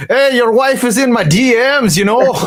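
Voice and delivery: a bad Irish accent, mocking the fighter's trash talk; terrible Irish accent. Rogan on the canvas, fighter mocking him in a terrible Irish accent. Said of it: "that's my terrible Irish accent".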